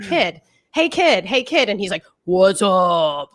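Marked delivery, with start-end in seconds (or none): 0.1-1.7 higher-pitched mock calling out; raised-pitch "hey kid" impression. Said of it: "they kept calling him kid. They're like". 2.3-3.3 mock grown-man voice on a kid; exaggerated forty-year-old man impression; manly man voice. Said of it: "this really manly voice".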